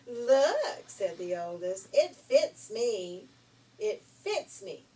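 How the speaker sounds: loud, slow triumphant shriek. Acted. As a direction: yell it like a gloating sister. Ugly sister shouts triumphantly.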